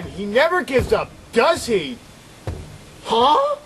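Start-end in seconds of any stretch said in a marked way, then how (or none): none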